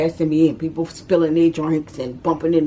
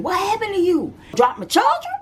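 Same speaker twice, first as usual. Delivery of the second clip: shocked cousin; 13 semitones higher than usual. Retold or imitated. Cousin to mama at the car, high and alarmed. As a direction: gasp it out in alarm.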